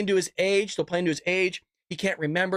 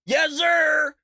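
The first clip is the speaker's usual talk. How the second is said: higher, louder mock "Yes, sir"; mock loud reply; 8 semitones higher, 5 dB louder than usual. Voter answering the poll worker, loud put-on voice. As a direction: bark it out in a put-on voice. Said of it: "He goes".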